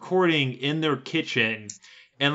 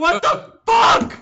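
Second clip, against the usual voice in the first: shrieked high and loud, slow; angry yell; 7 dB louder, slower than usual. Real, not acted. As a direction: scream it in disbelief. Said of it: "I know I'm yelling and I'm angry".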